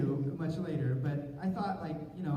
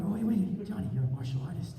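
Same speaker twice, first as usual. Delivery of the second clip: self pep talk; 2 semitones higher than usual. Retold or imitated. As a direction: coach yourself to focus.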